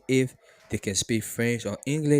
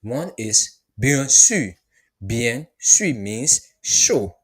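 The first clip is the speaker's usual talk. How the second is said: slow, louder bien sûr; slow teacher model; 5 dB louder, much slower than usual. Shown as a demonstration. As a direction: say bien sûr slowly and clearly. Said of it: "two ways the person can respond".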